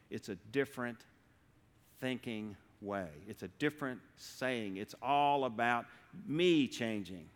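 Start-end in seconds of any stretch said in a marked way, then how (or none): none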